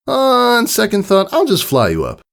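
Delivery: high, breezy, cocky tomboy voice. Acted. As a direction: toss it off with a grin. Rainbow Dash voice.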